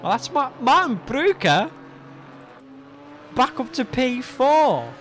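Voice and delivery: live commentator hype; excited commentary. Real, not acted. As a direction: call the position change with a lift.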